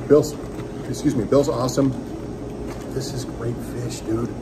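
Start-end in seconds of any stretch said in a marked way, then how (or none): none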